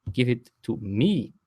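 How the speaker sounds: ordinary delivery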